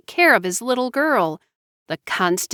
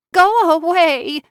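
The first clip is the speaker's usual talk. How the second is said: sobbed; 10 semitones higher, 4 dB louder than usual. A narrator's reading. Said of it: "she sobbed".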